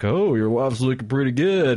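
lightly put-on leering neighbour; hammed-up line from a cheesy skit; mock porn neighbour. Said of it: "the neighbors are like".

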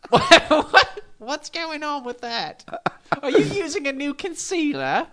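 high, comic English granny voice; old English lady. Acted. Podcaster asking Cynthia about her makeup, as an old English lady. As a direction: put on a high-pitched old lady from England. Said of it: "old English lady voice".

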